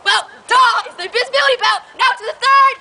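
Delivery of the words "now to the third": excited announcer. Acted. Infomercial announcing next test.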